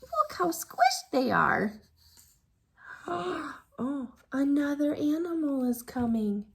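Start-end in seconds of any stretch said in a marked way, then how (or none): none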